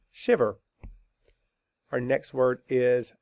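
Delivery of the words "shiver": normal talking voice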